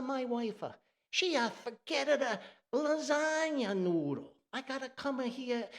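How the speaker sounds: high, frantic Italian neighbor voice; Italian accent. Retold or imitated. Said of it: "the Italian thing".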